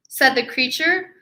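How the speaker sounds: plain reading voice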